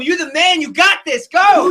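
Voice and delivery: high, loud, forceful hype; booming, intentional encouragement; powerful voice. Shown as a demonstration. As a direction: fire your partner up with total conviction. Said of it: "In the most powerful Intentional voice".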